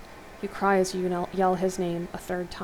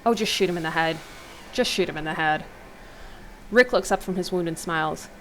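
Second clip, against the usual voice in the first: normal talking voice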